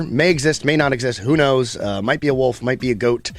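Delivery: ordinary delivery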